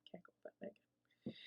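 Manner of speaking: breathy whispered repeat, barely audible; whispered aside. Pretend. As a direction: drop to a secret whisper. Whispered repeat of own line.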